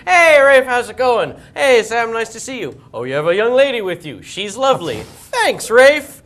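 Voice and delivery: high, loud staged hellos, both parts; rehearsed greetings. Retold or imitated. Star and fan rehearse a fake friendship, hammed-up greetings. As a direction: act out a chummy meeting between pals. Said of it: "get him to rehearse with me".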